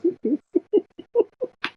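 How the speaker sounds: loud rising laugh; high burst of laughter. Real, not acted. Joke about wet underwear.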